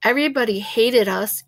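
ordinary delivery, nothing marked

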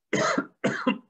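a dry unvoiced cough; coughing fit. Real, not acted. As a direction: cough hard, then apologise. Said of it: "закашлялся чего-то".

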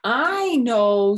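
high loud wow; Froggy impressed; amazed wow. Acted. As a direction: marvel out loud. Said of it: "said Froggy".

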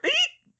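sudden very high startled squeal; a short shrill yelp of surprise. A narrator's reading. Hermione squeals as Ron grabs her in a hug, high squeal. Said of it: "she squealed".

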